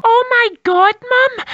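ordinary talk, nothing marked

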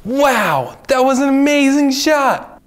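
high, loud amazed cheer; wow-struck coach shouting praise; excited praise. Acted. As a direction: cheer him on, thrilled. Kid's great shot in game.

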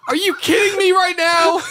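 high, loud, laughing outburst; exasperated shout. Retold or imitated. He yells at his Alexa, laughing and loud. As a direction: yell it in disbelief. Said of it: "I'm like".

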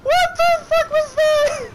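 slow, very high squeal of disbelief; shrieked disbelief. Real, not acted. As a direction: squeal it out, stretching each word. Reacting to the chaos.

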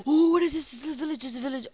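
high, loud amazed gasp; excited. Real, not acted. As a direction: gasp in wonder at the find. Discovers village.

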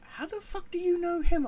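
high-pitched mock disbelief; incredulous ex-wife. Ex-wife questioning his impossible knowledge, high mock incredulity. Acting out ex-wife's startled question.

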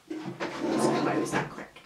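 breathy whispered aside. Real, not acted. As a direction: whisper it quickly. Unvoiced aside.

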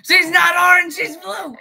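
high, loud shrieking. Real, not acted. Shrieks the claim.

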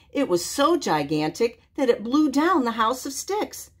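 ordinary delivery, nothing marked

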